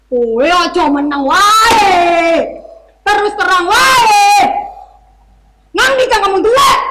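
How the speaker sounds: shouting in rage; angry shout. Acted. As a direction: yell it furiously. Angry officer yelling in Javanese.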